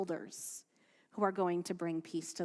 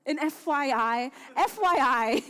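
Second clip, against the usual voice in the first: loud, laughing repeat of FYI; 6 semitones higher, 8 dB louder than usual. Real, not acted. Rabbi tells congregation about school note, laughing loud. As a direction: crack up over the letters.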